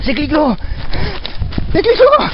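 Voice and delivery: panicked high screaming; scared horror game streamer. Acted. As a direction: shriek for your lost friend in terror. Sees the ghost clown, lost.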